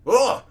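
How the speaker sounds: loud, high grunt of pain; pained cry. A narrator's reading. Wounded man struck by sudden pain, loud cry. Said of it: "sudden burst of pain".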